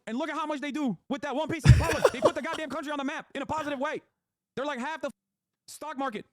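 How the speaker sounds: escalating fan rant; rising rant. Real, not acted. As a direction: hammer the point home loud. Escalating rant about CD Projekt.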